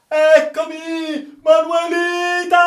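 very high, long-held scream; drawn-out, full-force delirious call; wild shout. Acted. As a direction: cry out madly as if leaping overboard. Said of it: "si slanciò a capofitto in mare gridando".

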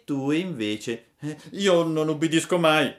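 put-on high puppet boy owning up; Pinocchio confessing. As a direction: admit it with a sheepish grin. Fairy then Pinocchio interrupts. (Pinocchio confessa, voce alta)

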